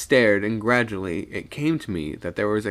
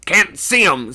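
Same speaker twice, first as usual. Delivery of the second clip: loud, raised angry retort; thoroughly angry; 9 semitones higher, 9 dB louder than usual. A narrator's reading. Old man scorning the second mate, thoroughly angry. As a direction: throw the words back angrily. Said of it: "now thoroughly angry".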